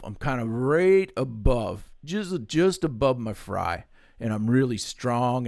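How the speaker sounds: strong chest voice with a country twang, slow; a hint of country voice. Shown as a demonstration. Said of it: "I got my good country voice going".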